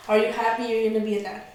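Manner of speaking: normal talking voice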